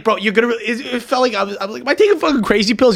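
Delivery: high, incredulous exasperated rant. Retold or imitated. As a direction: blurt it out in disbelief at the pettiness. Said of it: "and I was like".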